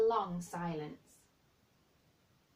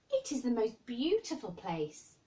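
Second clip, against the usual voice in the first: protesting; 6 semitones higher, 5 dB louder than usual. Acted. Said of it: "protested Mary".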